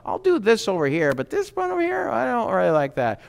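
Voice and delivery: high-pitched mock bargainer; haggling with God. You sit there and barter with God, mock haggling. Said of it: "sit there and barter with God".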